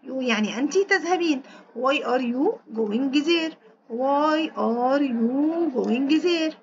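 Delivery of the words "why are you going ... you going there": slow dictation. Shown as a demonstration. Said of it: "أنت لماذا سوف تذهبين إلى هناك".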